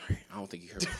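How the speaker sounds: hushed, breathy whisper; whispered aside. Pretend. Whispering as a fake team exec on the phone. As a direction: drop to a whisper mid pretend call.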